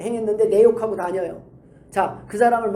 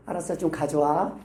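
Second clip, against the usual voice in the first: lower, gentler retold request; a touch softer, placating; nicer request; 5 semitones lower, 4 dB quieter than usual. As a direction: give in and ask nicely. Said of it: "예쁘게 말해주세요".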